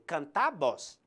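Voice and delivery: canta stressed on its final syllable, can-TA; stress on last syllable. Shown as a demonstration. Teacher illustrating the strongest syllable, canta stressed on TA. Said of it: "la última sílaba sería la más fuerte".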